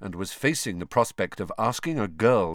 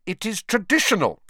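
high, loud, no-nonsense teacher; firmly; 12 semitones higher, 5 dB louder than usual. A narrator's reading. Professor overruling her student's refusal, firm. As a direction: state it as settled fact. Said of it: "said Professor McGonagall firmly".

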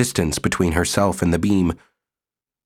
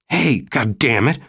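protesting; 5 semitones higher than usual. Acted. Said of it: "he protested".

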